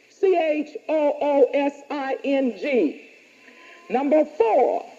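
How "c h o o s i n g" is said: C H O O S I N G letter by letter; spelled out. Shown as a demonstration. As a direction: spell "C H O O S I N G" one letter at a time.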